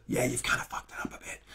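whispered stage aside; hushed, hurried mock scolding; confidential aside. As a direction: whisper it quickly to a supposed accomplice. Mock aside to supposed plant.

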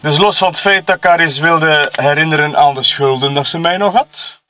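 normal talking voice

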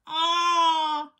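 long, high, loud "aah"; giant's cry. A narrator's reading. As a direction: yell out as a tripping giant. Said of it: "cried the giant".

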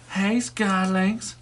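high, slow playful greeting; toy character voice. Pretend. Vlogger voices a toy greeting his friend, high playful call. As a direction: draw the name out slowly in a play voice.

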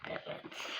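soft whisper. Real, not acted. Whispered aside.

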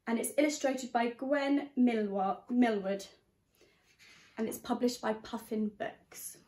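ordinary delivery

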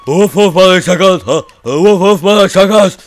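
high, loud woofing talk; mock dog barking. Gamer pretending to be a dog, loud mock barking. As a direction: bark the taunt like an excitable dog.

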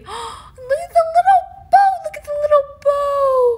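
very high, loud squealing; gushing. Real, not acted. Collector admiring the little bow, high squeal. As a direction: coo over it in a high squeal.

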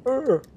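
high, loud mock "ugh" of disgust; imitated gorilla grunt. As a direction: mimic a keeling-over gorilla's groan. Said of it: "they fall over going".

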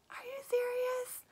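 very high-pitched disbelieving question; acted-out stunned reader reaction; incredulous. Reader talking back to the book's hero, incredulous.

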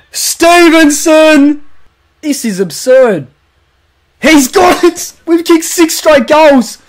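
high, drawn-out name call; excited goal call. Acted. Calling the sixth straight goal to viewers, elated. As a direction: roar the streak like a broadcaster.

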